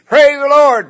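high, loud whooping shout. Acted. Rider urging his horse to a gallop, shouting. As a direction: really raise your voice to spur the horse. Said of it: "he wants to really open her up".

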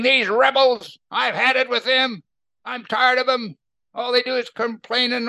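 hammed-up angry Moses griping about rebels; Moses fed up. Moses complains to God about the rebels, exasperated. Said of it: "but he's mad now".

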